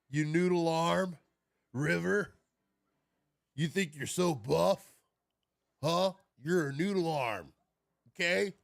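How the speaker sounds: hammed-up trash talk at River; mock tough taunt.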